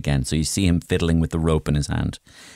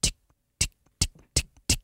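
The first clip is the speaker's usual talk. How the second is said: soft unvoiced ticks, like a reel clicking; fishing line ticking; 7 dB quieter, faster than usual. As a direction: tick it out under your breath. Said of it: "it just goes".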